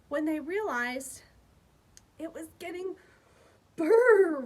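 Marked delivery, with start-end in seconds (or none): 3.8-4.4 teeth-chattering brr sound; loud shivering brr. A narrator's reading. Reading to kids, shivering brr at the cold.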